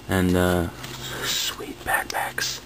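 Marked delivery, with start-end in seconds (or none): 1.2-2.6 unvoiced, slow whisper. Real, not acted. Showing off his backpacks in a slow whisper. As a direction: breathe it out, pleased.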